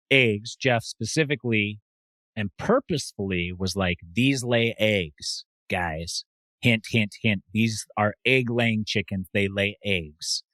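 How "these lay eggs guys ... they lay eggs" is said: very slow, pointed hint-hint. Retold or imitated. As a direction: spell it out slowly. Imitating host hinting slowly.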